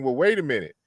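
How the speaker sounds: ordinary talk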